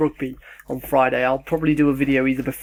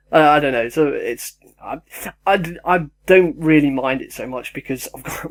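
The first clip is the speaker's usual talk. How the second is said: usual speaking voice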